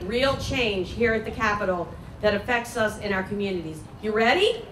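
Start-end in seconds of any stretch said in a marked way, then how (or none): none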